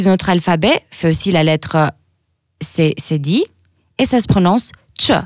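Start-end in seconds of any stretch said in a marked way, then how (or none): 5.0-5.2 ch said as in "tchèque"; ch starts with a t: "tcheu"; ch as "tchuh"; Albanian Ç sound. Shown as a demonstration. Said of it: "comme le mot tchèque".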